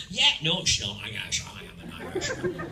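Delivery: low Scottish film-star impression; Sean Connery. Scottish actor insisting he's Irish, low Connery impression. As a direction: sink into deep Scots while claiming otherwise. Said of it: "he still sounded like Sean Connery".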